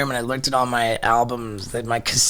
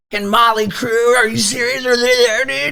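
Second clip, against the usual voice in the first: put-on high, loud, overexcited teen on the phone; excited kid; 9 semitones higher, 5 dB louder than usual. Thirteen-year-old fan reaching the manager's brother, bursting with excitement.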